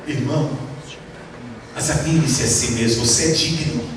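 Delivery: softly pious pastor, mock solemn. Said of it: "piedosamente, em oração".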